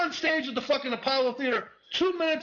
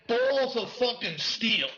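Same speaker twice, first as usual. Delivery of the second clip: slow punch; much slower than usual. Acted. Punchline repeat, drawn out.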